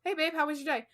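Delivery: mock-sweet hello to a partner; instantly melted greeting. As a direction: act suddenly disarmed, soft and high. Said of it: "go to him all hyped up".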